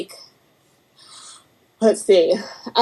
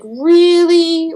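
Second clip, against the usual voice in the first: loud, high, stretched-out disbelief; drawn-out, high-pitched mock outrage; incredulous; 10 semitones higher, 6 dB louder than usual. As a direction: question it as if appalled. Retold disbelief at dog's name.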